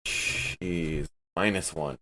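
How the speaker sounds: normal talking voice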